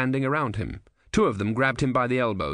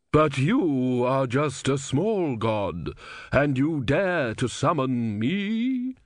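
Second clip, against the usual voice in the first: slow, disdainful goddess voice; scornful Sea Queen; 4 semitones higher, much slower than usual. A narrator's reading. Sea Queen mocking the small god Om, slow and disdainful. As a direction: drag it out, looking down on him.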